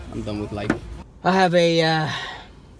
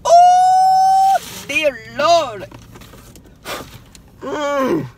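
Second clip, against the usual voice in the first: loud, much higher "Oh" of awe; amazed exclaim; 12 semitones higher, 7 dB louder, much slower than usual. Real, not acted. Seeing the figure he missed at Hot Topic, loud and amazed. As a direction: gasp it out in delight.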